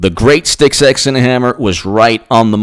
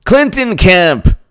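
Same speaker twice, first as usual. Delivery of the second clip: ordinary delivery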